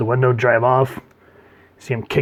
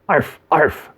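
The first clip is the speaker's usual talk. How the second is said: louder, raised yaps; two punchy mock barks; dog bark imitation; 5 semitones higher, 5 dB louder than usual. Narrator mimicking Joker's dog, barking.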